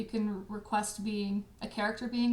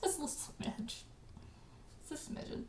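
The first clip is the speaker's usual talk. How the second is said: whispered aside; 4 semitones higher, 15 dB quieter than usual. Real, not acted. Host jokes about missing memes, in a whisper. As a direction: breathe the words without voice. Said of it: "whispered joke".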